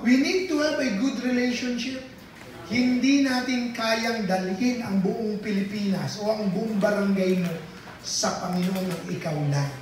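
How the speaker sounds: ordinary talk, nothing marked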